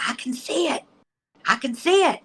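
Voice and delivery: high-pitched cry of wonder; amazed, high retold call; imitated excited shout. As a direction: imitate an old man crying out at a vision. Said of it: "he starts shouting".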